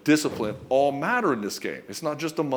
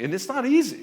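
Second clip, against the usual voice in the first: normal talking voice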